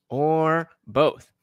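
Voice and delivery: normal talking voice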